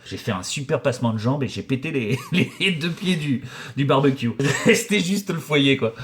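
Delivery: laughing through the words; telling it between laughs; a laughing, stretched-out confession; laughing while telling. Real, not acted.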